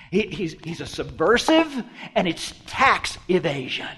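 ordinary talk, nothing marked

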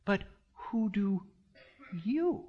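slow build to a high, isolated YOU; pause, then lift YOU right up; personal emphasis on YOU. Shown as a demonstration. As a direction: make YOU pointed and personal. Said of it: "He's emphasizing the personalness of this".

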